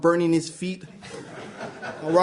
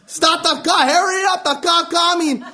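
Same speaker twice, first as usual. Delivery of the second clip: much higher, urgent yelling; yelled commands; 11 semitones higher, 4 dB louder than usual. Retold or imitated. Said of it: "My dad yelling commands at me".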